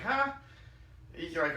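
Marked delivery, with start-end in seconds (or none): 0.0-0.3 a high, loud, baffled huh. Retold or imitated. Husband baffled by wife's answer, a high loud huh. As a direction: sound completely thrown by her answer.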